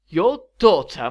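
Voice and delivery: loud, slow, high-rising disbelief; dramatic surprise. A narrator's reading. Maleficent throws the queen's words back at her, dramatically surprised. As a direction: voice soaring in theatrical astonishment. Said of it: "her voice rising in dramatic surprise".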